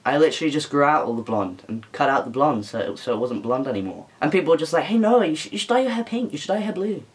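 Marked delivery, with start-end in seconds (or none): 4.8-7.0 higher, softening imitation of pushy followers; mimicked pestering advice. Followers telling someone to dye their hair, mimicked pestering.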